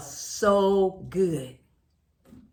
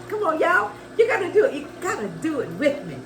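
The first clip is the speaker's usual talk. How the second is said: excited; 5 semitones higher, faster than usual. Real, not acted. Urging viewers to join.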